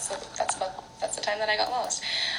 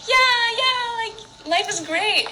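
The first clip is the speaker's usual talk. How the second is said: put-on much higher, slower, sing-song carefree kid; 4 dB louder, much slower than usual. Mimicking her younger self running round the supermarket, giddy and high. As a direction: play the giddy little girl without a worry.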